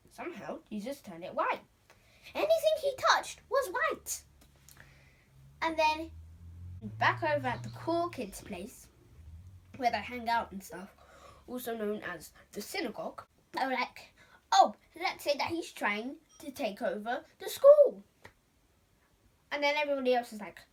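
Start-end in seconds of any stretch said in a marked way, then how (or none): 2.3-4.2 much higher and louder, full of wonder; squealing with wonder; excited high. A narrator's reading. Child storyteller exclaims wonder. 14.5-18.0 louder, scheming announcement; cool kids hatching a plot; loud plotting. Acted.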